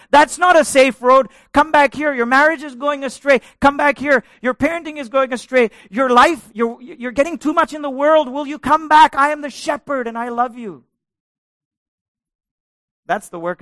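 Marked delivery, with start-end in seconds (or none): 0.1-10.8 higher, louder pleading call to wandering sheep; shepherd calling back. Acted. Shepherd calls his straying flock back, loud pleading. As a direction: call them home, urgent and loud. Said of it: "call us back with his voice and say".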